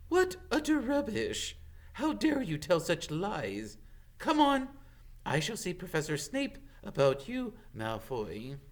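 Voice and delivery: high, stern teacher voice; stern scolding. Acted. McGonagall scolds Malfoy in the corridor, stern high. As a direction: dress him down.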